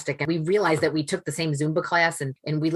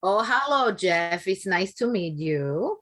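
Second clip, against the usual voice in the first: put-on higher Dominican-accented mama, slow and sweet; Dominican mother impression; 4 semitones higher, much slower than usual. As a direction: greet him as her Dominican mom, drawn out. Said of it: "there you go".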